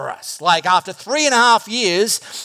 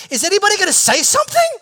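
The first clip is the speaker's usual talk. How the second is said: much higher, fast nervous blurt; awkward nervous disciples; 12 semitones higher, 4 dB louder, faster than usual. Retold or imitated. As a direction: squeak it out, anxious for someone to speak. Twelve looking at each other, awkward.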